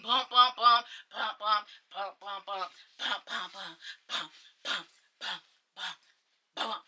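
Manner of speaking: low, soft vocal knocks, fading away; rhythmic mouthed door-knock beat, trailing off; imitated knocking.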